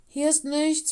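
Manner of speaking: high, loud call down; announcing. A narrator's reading. Lola up in the larch reporting back, calling out. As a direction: announce it for those below to hear. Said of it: "verkündete sie".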